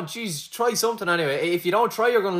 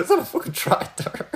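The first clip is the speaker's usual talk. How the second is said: laughing; 11 semitones higher than usual. Real, not acted. Laughing repeat of wrong answer.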